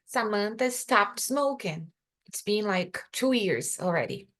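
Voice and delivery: slow, careful contrast read; slow example. Shown as a demonstration. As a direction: slowly give the other version. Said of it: "See the difference?".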